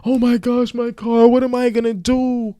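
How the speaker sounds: high, whiny mock lament; mock crying. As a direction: imitate someone wailing over a loss. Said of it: "you keep crying about your car".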